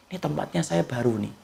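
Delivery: put-on a hushed little baby voice; soft baby's thought.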